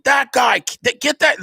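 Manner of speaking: high, mock crowd yell. Comedian imitating the angry crowd chasing Kyle, high yell. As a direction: shout it like an angry mob chasing him. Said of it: "It's just people yelling".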